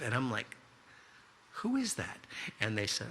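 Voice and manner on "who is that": high-pitched, awestruck question; smitten wonder. Retold or imitated. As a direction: ask it high, as if struck by love. Smitten at first sight, retold.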